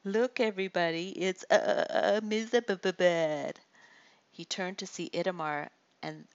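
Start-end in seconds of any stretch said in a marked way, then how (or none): 0.0-3.6 loud jeering call, stretched mock stammer on the name; mocking stutter taunt. Bully calls out to the crowd, mocking. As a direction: jeer at him by aping his stutter.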